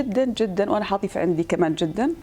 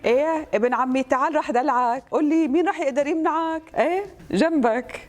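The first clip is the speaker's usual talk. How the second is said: higher, lilting Levantine Arabic; performed Levantine colloquial, pitched up; Lebanese-Syrian accent; 6 semitones higher than usual. Shown as a demonstration. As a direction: put on a Lebanese-Syrian dialect. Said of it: "اكيد في لبناني وفي سوري".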